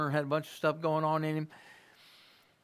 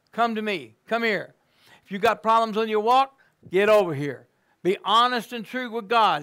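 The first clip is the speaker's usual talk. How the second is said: louder, higher preacher's call; full-force preaching; 5 semitones higher, 5 dB louder than usual. Acted. Preacher telling backsliders God is calling, full-force preaching. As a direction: preach it hard to the room.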